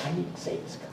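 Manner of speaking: barely voiced, near-whispered resolve; very soft inner voice; retold silent thought. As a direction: breathe it like a private thought. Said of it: "Not much word".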